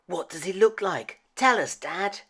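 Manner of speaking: put-on high, quick, bright child's voice; eager child. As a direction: pipe up like an excited little kid begging. Child asks father for story.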